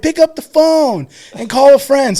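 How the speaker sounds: high, loud mock deputy; yelling female deputy. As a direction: imitate a woman DI taunting a recruit. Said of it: "Like, yelling at him".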